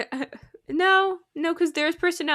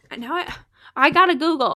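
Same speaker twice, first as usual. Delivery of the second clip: usual speaking voice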